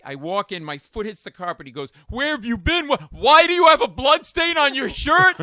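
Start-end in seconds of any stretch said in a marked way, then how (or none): 2.1-5.4 loud, high-pitched interrogation; incredulous shouted questions; outraged boss. Retold or imitated. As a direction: demand an explanation in alarmed outrage. Boss confronts him over absence.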